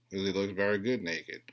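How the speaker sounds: ordinary delivery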